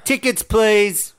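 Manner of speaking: high, drawn-out conductor's call; shouted taunt, acted out in a retelling; mock shout. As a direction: call it out loud and high, stretching the words. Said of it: "ran past him and shouted".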